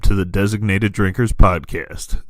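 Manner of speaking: low, slow, loud announcer read; deep slow show-opener voice; announcer intro. Acted. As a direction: boom it out like a radio intro.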